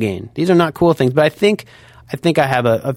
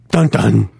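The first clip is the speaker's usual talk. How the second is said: vocal sting imitation; 4 dB louder than usual. Law and Order sting sound.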